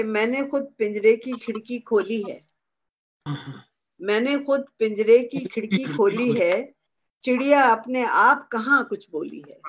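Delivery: mushaira-style poetic delivery; couplet recitation. Acted. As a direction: recite the couplet deliberately, line by line.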